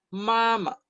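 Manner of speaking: "mama" with a high, level first syllable. Shown as a demonstration. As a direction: hold the first "ma" high, drop lightly on the second. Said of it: "En primer tono".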